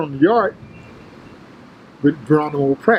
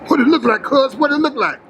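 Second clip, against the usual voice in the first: higher, swaggering challenge; cocky taunt; 8 semitones higher than usual. Retold or imitated. Tookie taunting his rival in the mirror, cocky. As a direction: strut it, daring him.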